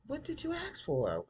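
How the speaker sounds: a high, baffled question; puzzled. Real, not acted. Viewer baffled by the scene, asking high. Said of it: "reacting to the show".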